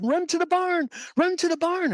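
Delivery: high-pitched mother-hen alarm; high, repeated warning cry; urgent warning. Acted. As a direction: call the chicks to safety, high and urgent. Hen warns chicks of shadow.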